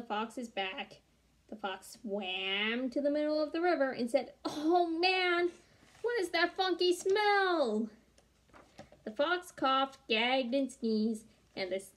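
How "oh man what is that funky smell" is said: high, slow disgusted groan; disgusted fox. Acted. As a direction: gag at the funky smell.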